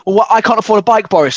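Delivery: hammed-up outraged objection; mock indignation. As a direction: protest like an affronted member of the public. Acting an indignant member of public.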